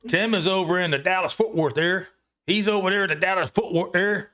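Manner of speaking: a light put-on Texas accent. Telling viewers where Tim lives, in a light Texas accent. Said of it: "Trying to get my Texas accent on".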